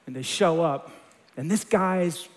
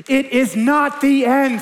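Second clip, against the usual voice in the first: slow, higher, louder declaration; preacher lands the promise to the congregation, louder and higher; 6 semitones higher, 7 dB louder, slower than usual. Real, not acted. Repeated climax.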